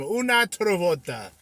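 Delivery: high, loud Russian-tinged Italian; Russian-sounding Italian. Shown as a demonstration. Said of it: "I sound Russian talking Italian".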